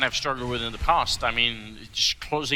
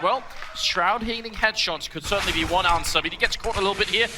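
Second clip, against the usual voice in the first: rising excited cast; 5 semitones higher than usual. Real, not acted. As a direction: build the hype as the duel closes. Clutch round call building up.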